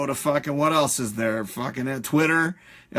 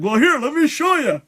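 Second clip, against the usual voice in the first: much higher, louder goofy voice; pushy moviegoer mocked, higher and louder; mock eager moron; 10 semitones higher, 6 dB louder than usual. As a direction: play the pushy idiot with glee.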